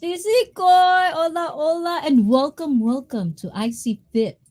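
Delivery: high, sing-out greeting easing down; excited welcome. Real, not acted. Streamer welcoming a viewer who just joined, excited welcome.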